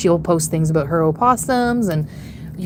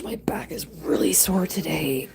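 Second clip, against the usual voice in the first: whisper; 5 dB quieter than usual. Real, not acted. Whispered complaint.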